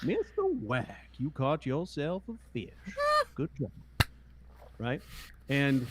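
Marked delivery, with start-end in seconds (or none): 0.0-3.7 Robard's voice. Retold or imitated. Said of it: "Robard would say".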